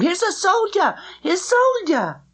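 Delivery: high, excited shout; children shouting with delight. A narrator's reading. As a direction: call out high and gleeful. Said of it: "shouting with delight".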